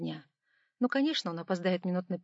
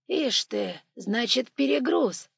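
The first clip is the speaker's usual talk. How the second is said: curious monk voice; 4 semitones higher, 6 dB louder, much slower than usual. Acted. Tyutin realizes the overload.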